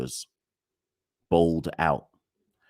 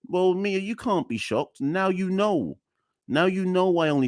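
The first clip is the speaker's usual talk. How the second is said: sarcastic, higher; 12 semitones higher than usual. Real, not acted. Addressing the PM sarcastically.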